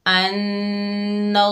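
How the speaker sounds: अन्नल्लाह with thick full lam and nasal hold, slow; heavy lam demo. Shown as a demonstration. Teacher showing students heavy lam of Allah, slow recitation. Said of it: "अल्लाह का लाम को मोटा पढ़ेंगे".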